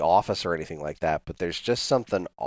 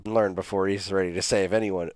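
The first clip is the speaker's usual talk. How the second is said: usual speaking voice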